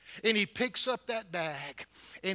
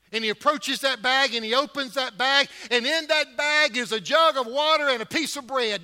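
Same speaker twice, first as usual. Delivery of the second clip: rising louder and higher; building sermon fervor; 6 semitones higher, 9 dB louder than usual. Acted. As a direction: lift the congregation. Preacher building up.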